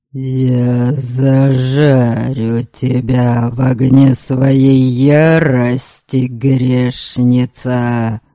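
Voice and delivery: low, slow rumbling spirit; rumbling ghost. A narrator's reading. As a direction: roar it out like a wrathful ghost. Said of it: "Пророкотал призрак".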